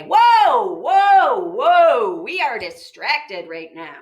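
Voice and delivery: loud alarmed halt, easing on the last; thinking voice interrupting. Acted. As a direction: call a sudden stop, each one swooping down. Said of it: "it's your thinking voice that's gonna say".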